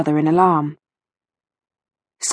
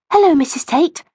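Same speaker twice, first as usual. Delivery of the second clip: put-on higher, polite girlish greeting; a hint of a young girl being polite; lightly voiced girl; 8 semitones higher than usual. A narrator's reading. Rachel greets her friend's gran, lightly girlish.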